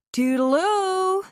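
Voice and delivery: very high, loud, stretched-out goodbye; cheery farewell. Acted. Haley waves goodbye with the glass, trilling high. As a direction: trill it out gaily as she runs off.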